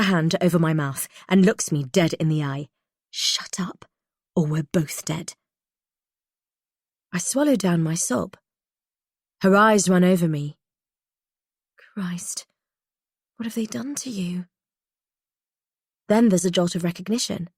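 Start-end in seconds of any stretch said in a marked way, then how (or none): 3.1-5.3 hushed, breathy warning; urgent whisper. Acted. Hushed threat. 11.9-14.5 soft, horrified whisper; shocked whisper. Acted.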